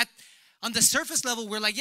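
ordinary talk

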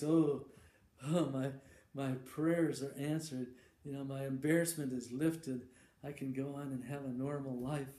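grateful Randy. Acted. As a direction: pour out relieved gratitude to the heavens. Randy thanks god at the altar.

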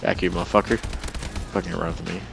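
normal talking voice

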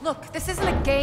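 forceful scolding; stern warning. Acted. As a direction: shout down his joking. Urgent warning to partner.

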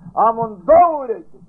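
God's warning rising loud and slow; stern warning. Retold or imitated. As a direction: drive the threat home. Repeated threat of punishment.

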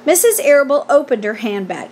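usual speaking voice

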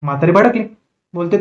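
ordinary talk, nothing marked

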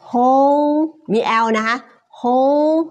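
high, held teaching "whole"; final L sounded. Shown as a demonstration. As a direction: stretch "whole" and end it with an L.

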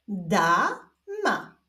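stretched first syllable, pause, then second; drawn-out, divided syllables; syllable reading. Shown as a demonstration. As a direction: read it slowly as two separate syllables. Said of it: "zapraszam Was do odczytywania sylab".

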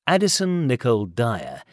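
ordinary delivery, nothing marked

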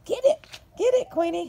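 high excited urging; excited cheering. Real, not acted. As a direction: cheer the dog on, high and bright. Cheering dog on.

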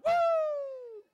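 high loud whooping cheer. Retold or imitated. Congregation cheering the word with a high whoop. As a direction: let out a joyful whoop.

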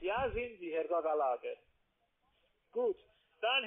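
normal talking voice

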